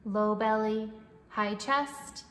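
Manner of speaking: calm, even breathing-coach voice; guided meditation. Acted. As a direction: guide the breath gently and steadily.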